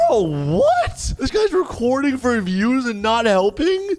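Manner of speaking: high-pitched, incredulous outburst; outraged disbelief. Acted. Reacting to a bystander filming, outraged disbelief. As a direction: exclaim in appalled disbelief.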